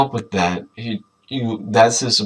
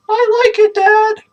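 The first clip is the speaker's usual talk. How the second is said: put-on high, louder kid voice; high girl Deb; 9 dB louder than usual. Deb telling Dad she likes the name, high. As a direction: chirp it to dad. Deb's voice.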